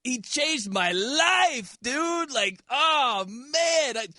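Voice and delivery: slow, dreamy, spaced-out hippie voice; stoner hippie voice. Retold or imitated. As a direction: drawl it out like a blissed-out rocker. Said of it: "that's what you sound like".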